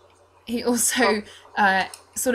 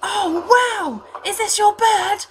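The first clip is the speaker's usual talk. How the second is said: put-on awestruck little boy, high and loud; Reese; 12 semitones higher, 5 dB louder, slower than usual. Altar boy meeting a stranger's bird, high-pitched wonder. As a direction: gush in a high, excited child's voice. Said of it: "twelve-year-old-ish looking blonde altar boy".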